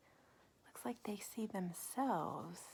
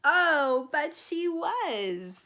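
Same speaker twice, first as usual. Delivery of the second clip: loud, high and slow reveal; surprised reveal; 7 semitones higher, 16 dB louder, much slower than usual. Acted. Revealing the cat's secret to the child, drawn-out surprise. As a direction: drag out the surprise. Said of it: "didn't seem to be waiting".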